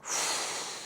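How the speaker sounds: a slow, soft breath out with a sigh; soft breathy exhale; long soft sigh. A narrator's reading. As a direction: let all the air go in a relaxed sigh. Narrator breathes out, relieved.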